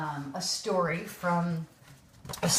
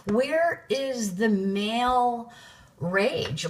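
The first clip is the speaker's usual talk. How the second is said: incredulous; 6 semitones higher than usual. Retold or imitated. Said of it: "I'm like".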